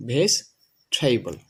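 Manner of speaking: ভেজিটেবল slowly split, "veis... theibol"; correct pronunciation. Shown as a demonstration. Teacher modelling the correct form, ভেজিটেবল as slow "veis... theibol". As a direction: drop the middle vowel of ভেজিটেবল, aspirate the t: "veis... theibol". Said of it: "এটা সঠিক উচ্চারণ হচ্ছে".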